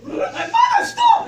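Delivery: high, loud laughing shriek; retold scream. Girl shrieking at her mother to stop, loud laughing yell. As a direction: yell it as the car rolls on.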